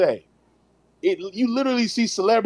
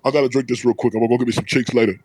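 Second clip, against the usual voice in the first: lower, mock-casual voice quoting a friend; imitating Jay; 7 semitones lower, much faster than usual. Teller mimics friend's boast about getting cheeks, low and fast. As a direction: drop low and toss it off like him.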